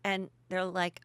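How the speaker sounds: usual speaking voice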